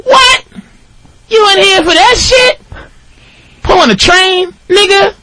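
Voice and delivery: very high-pitched, outraged disbelief; squeaking-high imitated outrage; mock incredulity. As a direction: act out astonished inmates, voice shooting way up. Inmates' incredulous reaction, acted out.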